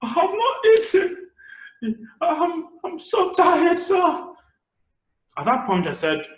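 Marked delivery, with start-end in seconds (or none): 0.0-4.3 much higher, pleading beggar voice; a hungry woman begging at a car window, high and slow with a laugh. Acted. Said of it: "She was like".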